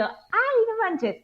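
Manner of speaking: shrieked high, startled and amazed; a sudden high-pitched gasp of disbelief; surprised exclamation. Retold or imitated. Gabriela hearing her new friend is Mexican, high amazed cry.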